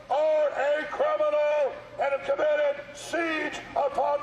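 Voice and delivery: real protester shouting accusations. Real, not acted. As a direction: project every charge at the building. Haranguing the mayor at a protest.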